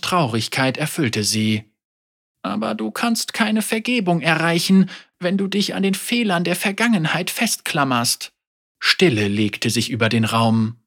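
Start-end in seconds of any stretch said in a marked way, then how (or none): 2.4-8.3 lightly voiced old teacher, higher pitched; a hint of a grieving elder speaking frankly; old woman Ying. Elder Ying tells Yasuo she holds a grudge, gently voiced.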